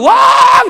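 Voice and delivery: very high, loud shouted shriek; two million birds screeching; screaming chickens. Retold or imitated. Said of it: "이러면서 날아오고 있어요".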